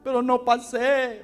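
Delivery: crying boy imitated. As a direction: plead it like a sobbing boy, slowly. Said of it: "pero no paraba de llorar". (niño llorando, imitado, más agudo)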